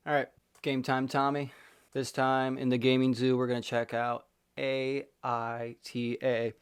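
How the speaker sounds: ordinary talk, nothing marked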